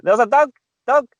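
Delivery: high, insistent repeated "dog"; imitating his wife. Husband imitating his wife correcting the child's "dog". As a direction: drill the word "dog" in a high voice. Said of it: "so she's like".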